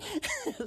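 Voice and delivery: high-pitched laugh after the impression; real, high giggle. Real, not acted. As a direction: laugh at your own story. Laughs at own imitation of kid.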